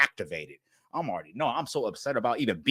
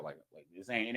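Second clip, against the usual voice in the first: normal talking voice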